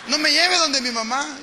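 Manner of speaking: put-on scared child pleading. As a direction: beg not to be taken home. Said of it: "le decía".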